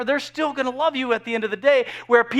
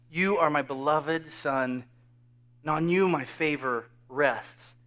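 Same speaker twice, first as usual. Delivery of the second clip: tender voice of God; 6 semitones lower, 7 dB quieter, slower than usual. Retold or imitated. Quoting God's voice, slow and soft.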